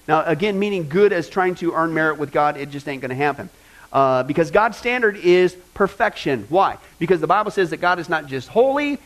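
usual speaking voice

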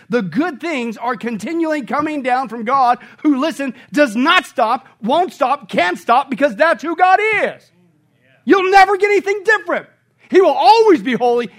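loud, high, ringing sermon climax; full-force preaching. Acted. Pastor thundering to his congregation about God's unchanging goodness, loud and soaring. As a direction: preach it out to the rafters.

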